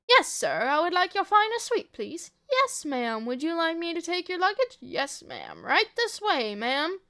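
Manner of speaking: hammed-up hotel roleplay, pitch swooping; mock-formal service voice with big pitch jumps; play-acting hotel guest and porter. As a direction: play both guest and obliging bellhop. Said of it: "Ellie acts out".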